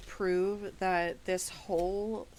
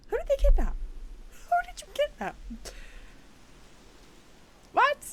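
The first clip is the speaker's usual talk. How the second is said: much higher, disbelieving question; incredulous squeak; 7 dB louder, faster than usual. Real, not acted. Judge asking the defendant who was kidnapped, squeaky disbelief.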